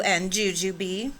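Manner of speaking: ordinary delivery, nothing marked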